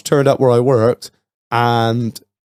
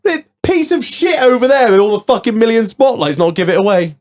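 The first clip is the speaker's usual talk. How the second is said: high-pitched, hammed-up incredulous rant; mock indignant outburst; 11 semitones higher, 3 dB louder than usual. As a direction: blurt it out high, mock exasperated. Retold indignant comeback to Josh.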